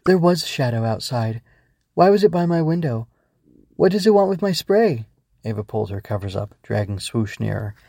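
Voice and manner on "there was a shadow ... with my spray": put-on higher, rising child's voice; anxious questions climbing higher; worried child. Ava tells the Growbug about the shadow, worried.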